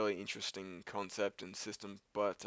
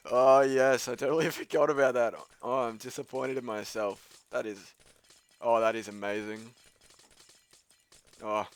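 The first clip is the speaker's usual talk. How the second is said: put-on Scottish or Irish accent; 3 semitones higher, 10 dB louder than usual. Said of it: "put on, like, a Scottish and or Irish accent".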